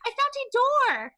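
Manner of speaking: high-pitched call to those below; screaming down. Acted. Player shouts down to the fighting party, high acted yell. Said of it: "I'm just gonna scream down".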